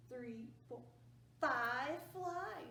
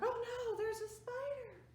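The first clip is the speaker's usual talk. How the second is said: high, loud startled cry of oh no; mock alarm at a spider; alarmed family; 9 semitones higher, 5 dB louder than usual. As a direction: gasp it out like they just saw a spider. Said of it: "The family looks like they're like".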